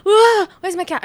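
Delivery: high, loud burst of alarm; acted freak-out. Owner waking to look for the cat, acted freak-out. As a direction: re-enact panicking on waking. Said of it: "I'm freaking out".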